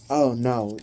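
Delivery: ordinary talk, nothing marked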